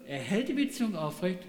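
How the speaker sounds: normal talking voice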